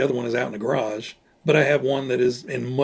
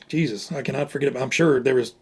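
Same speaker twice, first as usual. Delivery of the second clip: ordinary talk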